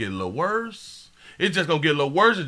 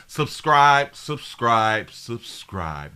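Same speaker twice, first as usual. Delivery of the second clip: slow, dropping lower each subscribe; 8 semitones lower, much slower than usual. Shown as a demonstration. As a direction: drag each subscribe out slowly. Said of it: "Let me say it slow".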